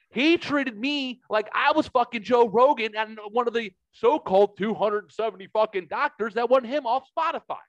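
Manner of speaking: ordinary talk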